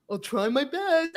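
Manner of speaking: slow, meek juror repeating himself, lightly voiced; drawn-out, noncommittal hedge; hesitant juror again. Juror repeating his answer to the prosecutor, slow and meek. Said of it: "He says it again".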